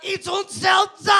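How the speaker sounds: high, slow shouted German accent. Shown as a demonstration. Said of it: "you do a German accent".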